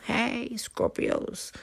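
slow, soft, teasing shout-out; flirty greeting. Acted. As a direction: drawl it out like a wink.

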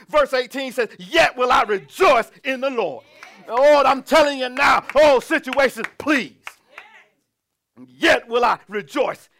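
loud, emphatic pulpit delivery; full-force preaching. Real, not acted. Pastor urging the congregation to rejoice in trouble, full-force preaching. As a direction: preach it hard, driving each phrase home.

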